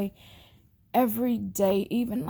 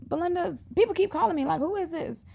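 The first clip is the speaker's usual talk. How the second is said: much higher and fast, baffled; puzzled exclaim; 9 semitones higher, faster than usual. Retold or imitated. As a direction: rattle it off, high and bewildered. Retelling puzzled call to sister.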